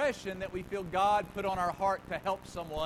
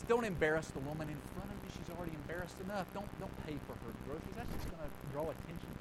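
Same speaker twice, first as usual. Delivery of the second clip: fast anxious excuses; 4 semitones lower, 5 dB quieter, faster than usual. Acted. Voicing the second voice's excuses.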